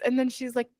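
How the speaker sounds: normal talking voice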